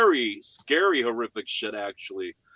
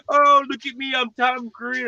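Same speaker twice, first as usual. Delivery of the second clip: higher, louder mock show-off; Tom Green, mocked; 5 semitones higher, 4 dB louder than usual. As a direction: put on a carefree, look-at-me voice. Mocking Tom's carefree self-regard.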